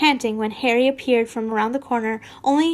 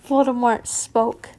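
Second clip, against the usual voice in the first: ordinary delivery, nothing marked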